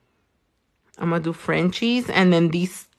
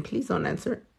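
much softer, almost under the breath; softly pleading; 10 dB quieter, faster than usual. Real, not acted. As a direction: drop the volume as a gentle request. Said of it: "giving you guys a trick question".

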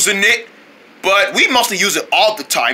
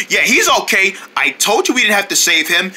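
ordinary talk, nothing marked

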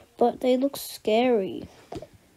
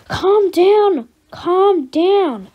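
loud, higher calm down; a parent yelling at the panicking daughter; shouting calm down; 6 semitones higher, 8 dB louder, much slower than usual. Acted. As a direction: yell at her to calm down.

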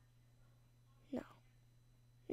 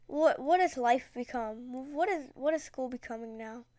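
normal talking voice

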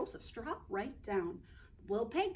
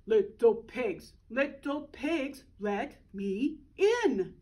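slow, louder wolf call; 7 dB louder, slower than usual. Acted. Wolf at the straw house, calling slowly. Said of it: "the wolf called".

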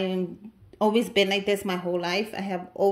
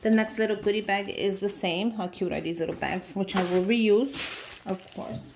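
ordinary talk

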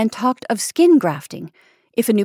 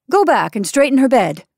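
loud, higher-pitched order; stern command; 5 semitones higher, 6 dB louder than usual. A narrator's reading. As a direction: order a subordinate about sternly. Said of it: "commanded the senior sternly".

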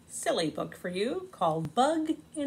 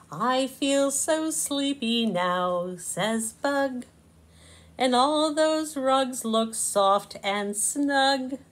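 slow, drowsy little bug; sleepy Bug; 6 semitones higher, 4 dB louder, much slower than usual. Acted. Bug telling Slug he feels sleepy, slow and drowsy. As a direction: let the words droop with sleep. Bug's lines in read-aloud story.

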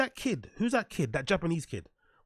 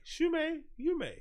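high silly accent; 13 semitones higher, 4 dB quieter, slower than usual. Shown as a demonstration. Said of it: "Is that racist if I do that?".

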